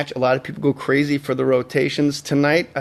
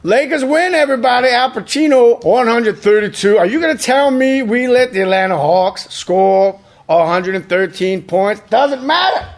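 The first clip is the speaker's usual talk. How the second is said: victory hype; 7 semitones higher than usual. Acted. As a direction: celebrate the win like an announcer. Hammy victory announcement.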